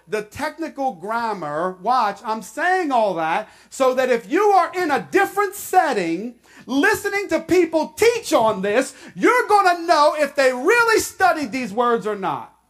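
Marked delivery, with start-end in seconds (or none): 1.8-12.6 louder, driving exhortation; urgent pulpit exhortation; preaching at full force. Acted. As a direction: press the point home.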